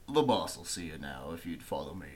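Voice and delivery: low, quiet servant voice; orc servant. Acted. Orc servant leads them.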